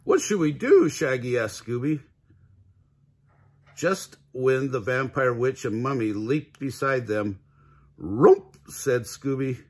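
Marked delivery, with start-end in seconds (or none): 0.0-0.9 high, loud, storybook alarm; worried question. A narrator's reading. Shaggy panics, cornered by monsters. 8.0-8.6 loud talking-dog command; Scooby's dog voice. A narrator's reading. Scooby calls jump in dog speech.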